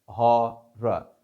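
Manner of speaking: हॉरर drawn out slowly as hɔra, middle R sounded; slow horror. Shown as a demonstration. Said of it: "लिहाजा आर पढ़ा गया".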